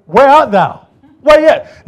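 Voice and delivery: God calling out to Adam. Retold or imitated. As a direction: call out searching for a lost one. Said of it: "What did he say to Adam".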